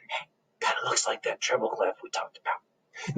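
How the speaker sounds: hushed, unvoiced whisper; whispered aside. Real, not acted. Aside to students mid-story.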